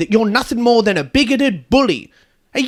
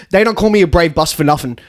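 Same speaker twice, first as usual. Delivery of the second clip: ordinary talk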